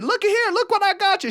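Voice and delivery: high, eager call; lightly excited; proud show-off. Retold or imitated. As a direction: call out proudly to your wife. Showing off ring to wife.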